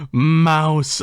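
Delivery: high, loud acted call; cry out. A narrator's reading. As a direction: call out to the mouse in desperation. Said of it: "I cry".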